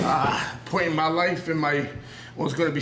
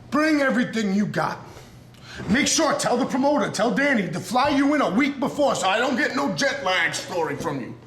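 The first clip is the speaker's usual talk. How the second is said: emotional callout; 5 semitones higher than usual. Real, not acted. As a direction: call him out with everything you've got. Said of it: "now I'm getting emotional".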